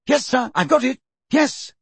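high, eager and joyful. A narrator's reading. Percy scanning his notes for Fudge, joyfully. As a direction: confirm it high, delighted to oblige. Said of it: "scanning his notes joyfully".

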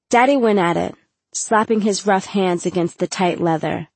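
usual speaking voice

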